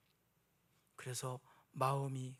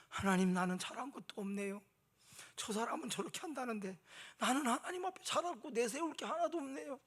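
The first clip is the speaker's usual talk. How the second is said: humble tearful prayer; 6 semitones higher than usual. Retold or imitated. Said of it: "큰 소리로도 말 못하고 눈물만".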